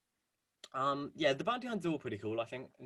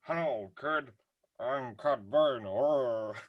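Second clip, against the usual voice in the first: slow, lightly drawled bounty hunter voice; a hint of a menacing gunslinger; Cad Bane; much slower than usual. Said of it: "you've kinda got Cad Bane, who's kind of like".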